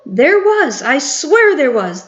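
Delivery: high indignant protest; shouting back. A narrator's reading. Cook shouting back at the staff, high indignant protest. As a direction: insist it, outraged. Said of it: "The man was shouting back".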